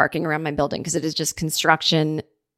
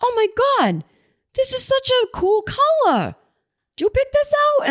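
very high-pitched mock amazement; delighted delivery guy. Furniture guy admiring customer's pink chairs, delighted. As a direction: imitate a gushing, impressed tough guy.

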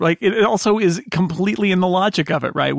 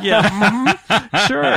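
laughing, knowing mm-hmm; sarcastic, amused yeah sure; sarcastic agreement; 5 semitones higher, much slower than usual. Retold or imitated. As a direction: humor her with a doubtful sure. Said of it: "I was like".

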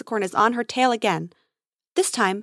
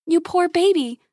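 higher, sympathetic coo; cooing pity; 7 semitones higher, 3 dB louder than usual. A narrator's reading. Grace finds the injured baby creature, cooing pity. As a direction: coo over a hurt baby animal.

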